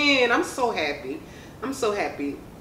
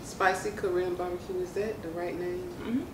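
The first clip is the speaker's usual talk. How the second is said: wing near lips; talking while eating; 5 semitones lower, 3 dB quieter than usual. Real, not acted. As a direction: mouth partly full. Seen 0: Right woman likely speaks, wing held at lips between bites.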